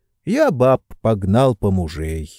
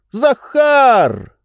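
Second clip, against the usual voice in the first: louder second call; 14 semitones higher, 5 dB louder than usual. Acted. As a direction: yell the name louder. Calling louder second time.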